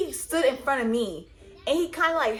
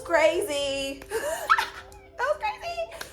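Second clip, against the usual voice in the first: high laugh then high, laughing words; laughing about the fight that never happened; 13 semitones higher, slower than usual. Real, not acted. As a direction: laugh it off in disbelief. Laughing at the memory.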